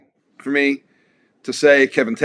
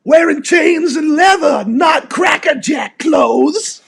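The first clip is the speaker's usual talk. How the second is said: mock metal shout; 4 dB louder, much slower than usual. Mocking a metal band's style.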